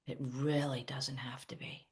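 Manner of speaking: soft, unvoiced whisper; whispered reassurance. Real, not acted. Coach reassuring viewers that it is simple, in a whisper. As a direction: breathe it out to calm them.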